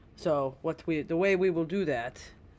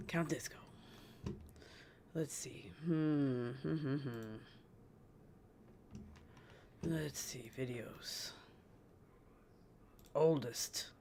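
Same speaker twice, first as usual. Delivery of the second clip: whisper; 10 dB quieter than usual. Real, not acted. Whispered searching to self.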